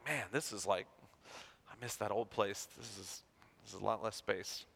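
low, soft, mopey complaint; mock glum. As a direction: sigh it out like missing home. Missing the bigger old place.

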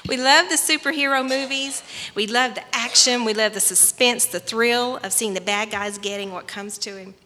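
loud fervent preaching; full-voiced pulpit preaching. Preaching to the congregation.

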